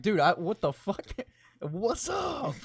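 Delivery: high laughing yell of surprise; excited shout. Retold or imitated. Excited greeting to old viewer.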